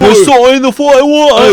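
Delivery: high, very loud mock rough English yell; rough English accent. Mocking the hard-to-understand character, shouting in an English accent. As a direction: belt it out in a hammed-up English accent. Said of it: "rough English accent".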